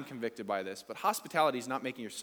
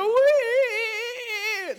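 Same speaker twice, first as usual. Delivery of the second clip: long high squealed "wee"; drawn-out, loud, high mock squeal; mock feeble cheer; 10 dB louder than usual. Preacher imitates the congregation's poor cheer, mock squeal. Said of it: "that was like a dead chicken".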